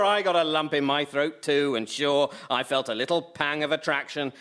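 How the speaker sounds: ordinary talk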